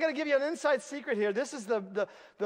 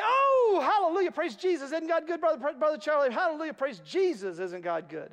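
high, loud, ecstatic hallelujahs; gushing church lady; 6 semitones higher, 3 dB louder than usual. Retold or imitated. Pastor imitating an overexcited churchgoer, high and gushing. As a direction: gush like an over-the-top believer. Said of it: "she was extroverted with her faith".

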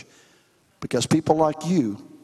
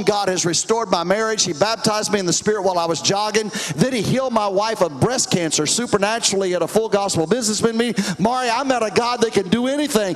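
higher-pitched, urgent retold testimony; fervent testifying attorney; 6 semitones higher than usual. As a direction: pour out the conversion story like a witness at church, building higher.

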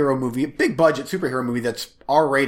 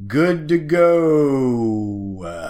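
very slow, stretched and louder; drawn-out mic test; 3 dB louder, much slower than usual. Shown as a demonstration. Testing his mic for the co-host, very slow stretched call. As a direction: stretch every word like a test announcement. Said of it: "my official testing of the mic".

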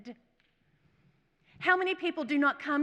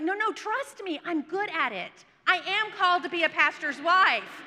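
high, pleading self-defence; insistent protest; 6 semitones higher, 5 dB louder than usual. Retold or imitated. Woman assures her pastor friend she is called, insistent protest. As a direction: protest emphatically, pushing louder to the end.

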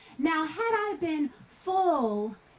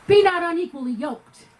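preaching; 2 semitones higher, 9 dB louder than usual. Real, not acted. Preacher quoting scripture.

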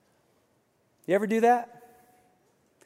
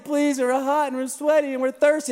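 put-on higher-pitched whiny kids' plea; imitating whiny kids; 8 semitones higher than usual. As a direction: mimic tired children begging. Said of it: "my kids were crying, literally crying".

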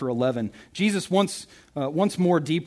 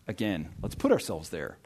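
ordinary talk, nothing marked

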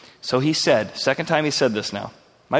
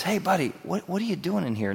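a soft, hushed, friendly question; soft whispered call; 3 semitones higher, 7 dB quieter than usual. Acted. God whispering into Elijah's cave, hushed and friendly. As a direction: lean in and ask softly. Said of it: "when God whispers in and says".

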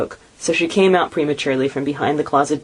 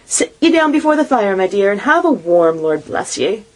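fond mother; 5 semitones higher, 3 dB louder than usual. A narrator's reading. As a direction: settle her by the fire. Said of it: "said Missus Cratchit".

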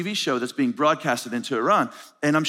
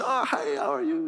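put-on high-pitched greeting in a thick African accent; 13 semitones higher than usual. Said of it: "in the thick accent".

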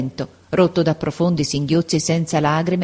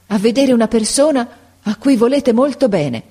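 raised pitch, mild agitation; held-back excitement, pitched higher; growing agitation; 7 semitones higher, 4 dB louder than usual. A narrator's reading. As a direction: build up to big news, a little agitated. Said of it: "sempre più agitata".

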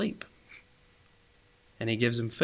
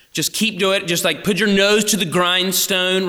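higher, pushy pep talk; imagined stern voice; 7 semitones higher, 5 dB louder than usual. Retold or imitated. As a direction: imitate a hard-driving taskmaster. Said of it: "I hear his voice in those moments".

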